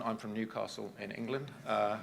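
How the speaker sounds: normal talking voice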